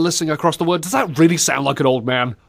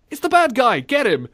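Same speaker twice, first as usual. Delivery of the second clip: higher-pitched play-acted alarm; mock pursuer; 10 semitones higher than usual. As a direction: sound the alarm on a villain. Acting out game scene.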